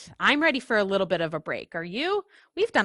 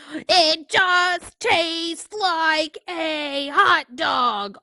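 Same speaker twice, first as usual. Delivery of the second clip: higher, louder irritated pigeon, slow on "hot dog"; exasperated pigeon; 8 semitones higher, 6 dB louder, slower than usual. Acted. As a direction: lose patience with the pest.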